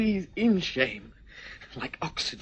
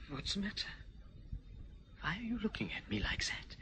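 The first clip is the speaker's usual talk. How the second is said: frightened whisper; 6 semitones higher, 13 dB quieter, faster than usual. Acted. Alarmed at Clive.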